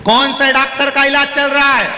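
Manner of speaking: high, loud mock shouted question; loud asking. Half-deaf visitor asking about the doctor, mock loud. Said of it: "चिल्ला के बोलता है".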